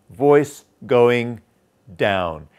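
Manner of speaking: stepped descent with a big drop on DOWN; stepping down in notes. Shown as a demonstration. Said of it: "the down really drops".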